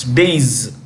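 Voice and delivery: days as one lengthened syllable; correct pronunciation demo. Shown as a demonstration. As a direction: say days slowly, rhyming with "haze". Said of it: "this is the pronunciation".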